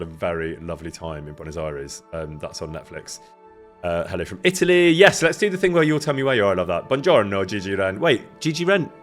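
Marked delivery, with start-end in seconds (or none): none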